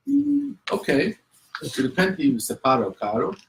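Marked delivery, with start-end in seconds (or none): 0.1-1.1 put-on much higher, small kid's unsure "ok"; a little boy not quite convinced; doubtful child. Small boy half-accepting his mother's answer, doubtful.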